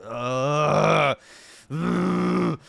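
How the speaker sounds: getting angry. Real, not acted. Streamer fumes at the suburb footage, loud angry groans. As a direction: growl out the frustration, building up. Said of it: "I'm getting angry".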